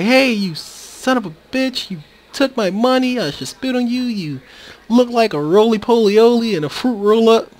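high-pitched mock tirade; comic high-voiced insult rant; upset. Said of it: "This one looks kinda upset".

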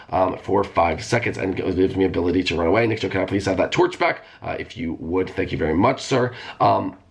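usual speaking voice

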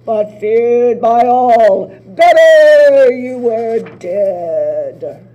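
very slow, loud, doom-laden oracle voice; ominous prophecy. Acted. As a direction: intone it like a dark prophecy. Sisters intoning the oracle's prophecy.

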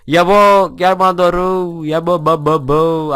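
loud, drawn-out call to a player. Real, not acted. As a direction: summon him over, slowly and loud. Calling out to player.

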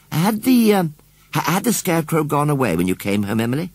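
put-on a child asking eagerly; a hint of a young girl; Susan. A narrator's reading. Susan voiced asking Emily.

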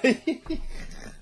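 real high, voiceless laugh; breaking into laughter. Real, not acted. Blogger flubbing his rant a second time, cracking up. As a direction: crack up halfway through the retake.

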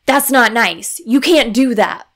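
put-on loud, sharp scolding of a small child; harsh tone. Shown as a demonstration. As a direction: bark it like a fed-up parent. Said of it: "a harsh tone".

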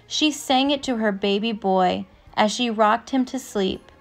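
usual speaking voice

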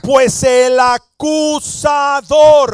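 grita el sermón, más fuerte y lento; predicación a todo pulmón. Acted. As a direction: proclama con fuerza ante la congregación. Preacher shouting about the accuser. (voz de predicador que grita)

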